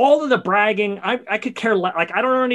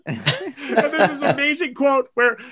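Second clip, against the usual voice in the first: laughing; 6 semitones higher than usual. Real, not acted. Still laughing.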